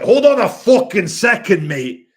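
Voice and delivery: imitating the first minister, loud outraged rant; mock angry rant. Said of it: "Hamza was like".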